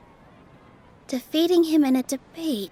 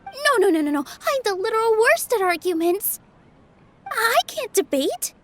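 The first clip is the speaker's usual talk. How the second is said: flustered protest; 5 semitones higher than usual. Acted. Shy dancer refusing to debate a researcher, flustered and higher. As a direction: back away from the job in a fluster. Panicking at being chosen to debate.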